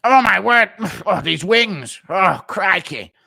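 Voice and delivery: high, loud, English commentator voice; a hint of a greedy commentator gushing over wings; Bisping impression. Streamer mimics a commentator eating wings, lightly voiced English caller. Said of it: "Impression of Bisping ferociously eating while calling a fight".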